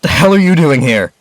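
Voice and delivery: startled shout. Acted. Startled young man shouts at the intruder at the grave. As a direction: snap it out in shock.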